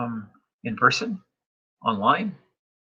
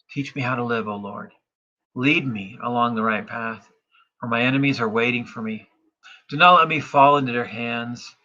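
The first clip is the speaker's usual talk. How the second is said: louder, confident psalm proclamation; psalm reading; 4 dB louder than usual. Acted. Pastor reads psalm to congregation, confident proclamation. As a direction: proclaim the verses with conviction.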